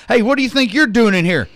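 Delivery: loud, high outraged yell; furious bartender. Retold or imitated. Bartender confronts the returning customer, loud and outraged. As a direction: blast it like a barman who spots a banned guy. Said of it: "he came flying over".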